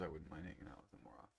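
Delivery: whisper. Real, not acted.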